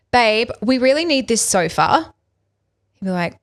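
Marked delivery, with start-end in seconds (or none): 0.1-2.1 pushy demand. Retold or imitated. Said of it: "if I was to come in and say".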